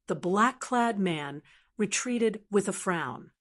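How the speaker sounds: plain reading voice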